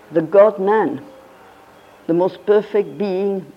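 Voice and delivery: ordinary talk, nothing marked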